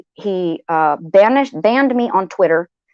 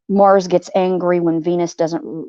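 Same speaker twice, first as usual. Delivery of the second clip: ordinary talk, nothing marked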